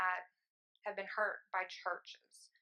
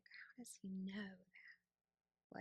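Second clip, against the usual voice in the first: 1 whispered wonder; 12 dB quieter, much slower than usual. Retold or imitated. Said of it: "I would be like".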